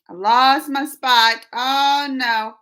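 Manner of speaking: dismayed exclaim. Real, not acted. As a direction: announce the lost place to the chat. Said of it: "Uh-oh, I lost my spot".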